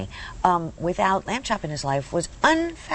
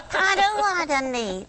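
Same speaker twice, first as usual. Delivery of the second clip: said without moving lips; 9 semitones higher, slower than usual. Shown as a demonstration. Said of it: "Can you say it without moving your lips".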